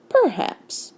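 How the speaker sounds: coolly drawn out, starting high and falling; a touch of icy scepticism; lightly voiced cold reply. A narrator's reading. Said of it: "in a cold voice".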